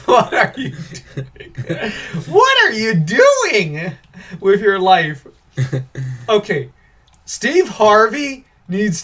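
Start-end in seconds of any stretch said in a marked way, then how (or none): 0.1-1.9 incredulous laughing. Real, not acted. Cracking up at the reviewer. 2.3-5.2 high, loud, drawn-out question; exasperated disbelief. Real, not acted. Berating the absent reviewer in disbelief.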